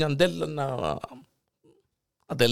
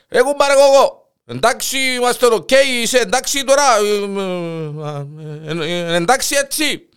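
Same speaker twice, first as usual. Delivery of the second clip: very loud, high call next door; loud high shouting; 9 semitones higher, 7 dB louder than usual. Acted. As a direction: yell the check-in through the wall. Calling through the wall, louder. (δυνατή, ψηλή φωνή από τον τοίχο)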